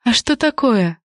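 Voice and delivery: lightly innocent, playing unaware; feigned innocence. A narrator's reading. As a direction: ask as if you suspect nothing. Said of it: "невинно спросила".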